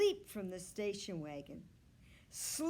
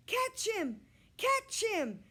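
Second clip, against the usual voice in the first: put-on high, loud little girls' screams; screaming; 13 semitones higher, 7 dB louder than usual. A narrator's reading. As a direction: scream after the runaway dog. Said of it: "screamed the younger girls".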